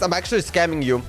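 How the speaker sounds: normal talking voice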